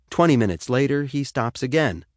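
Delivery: ordinary delivery, nothing marked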